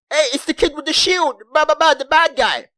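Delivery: mocking townsfolk.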